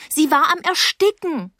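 high, urgent outburst; upset girl protesting; desperate insistence. Acted. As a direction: burst out in alarm.

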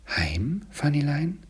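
rising, tentative disbelief; wondering question. A narrator's reading. Boy asks his little sister, wondering.

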